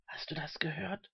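soft, hushed whispered question. A narrator's reading. Old man asking his companion, hushed whisper. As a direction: whisper it to the person beside you. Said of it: "flüsterte der alte Mann".

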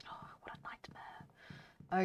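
slow, frustrated whisper. Real, not acted. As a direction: breathe it out slowly, defeated. Frustrated after losing horse.

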